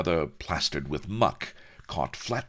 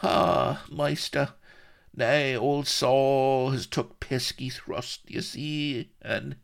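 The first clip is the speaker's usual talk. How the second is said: put-on high, slow, fading old gardener voice; weakly wounded old man; 10 semitones higher, slower than usual. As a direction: gasp it out as your strength goes. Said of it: "he coughed weakly".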